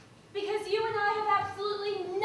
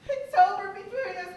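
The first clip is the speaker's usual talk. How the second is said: ordinary delivery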